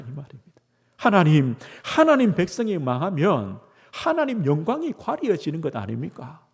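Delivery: normal talking voice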